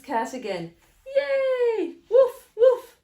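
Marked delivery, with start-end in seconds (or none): 1.1-2.0 high, loud yay; drawn-out gleeful shout; happy cheer. Acted. Everyone cheers the cat's return. 2.1-3.0 high, very loud woofs; punchy playful dog bark. Acted. Happy dogs greet the cat.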